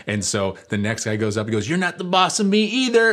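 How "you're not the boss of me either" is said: put-on high, loud childish taunt; acted-out playground defiance, pitched way up; mock defiance. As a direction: crow it back like a cocky kid. Next boy shouts defiance from roof.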